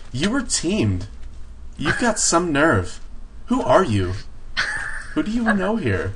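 put-on high, valley girl snark; mock sorority girl attitude. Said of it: "talking like a sorority girl".

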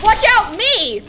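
half-laughing loud yell; shouted warning. Real, not acted. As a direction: yell it in a panic. Dodging in fight.

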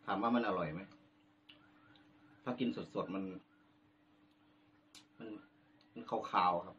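speaking between bites of tomato; talking while chewing. Real, not acted. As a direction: mouth partly full of tomato. Seen 0: Bitten tomato in hand, lips closed chewing between bites while talking.

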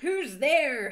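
high, loud mock-angry challenge; angry shout. A narrator's reading. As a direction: bellow at an intruder, hammed up. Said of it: "he shouted angrily".

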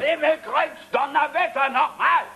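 high, thundered curse; exasperated shout. Acted. Bystander stops father and son fighting, exasperated shout.

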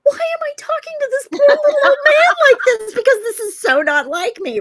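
high, loud mock-frantic self-questioning; panicked inner voice. Woman to herself while talking to a stranger, mock-frantic. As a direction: squeal at yourself in comic disbelief. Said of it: "And in my head, I'm going".

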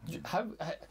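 ordinary talk, nothing marked

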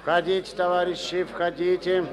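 loud, high welcome; calling in. Acted. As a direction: call them in loudly. Calling visitors in. (громко зовёт войти)

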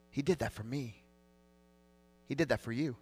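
quiet, moved voice, holding back tears; emotional. Real, not acted. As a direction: let the emotion soften each word. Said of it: "I always get emotional".

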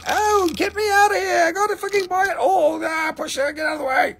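high shrieking crowd of shoppers; mock frantic buyers. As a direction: shriek like a buyer fighting for stones. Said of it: "They were like".

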